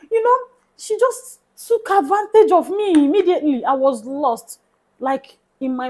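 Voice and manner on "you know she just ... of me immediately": laughing as she tells it, voice pitched up; amused disbelief, laughing through the words. Real, not acted. Laughing while telling friends how she was fooled.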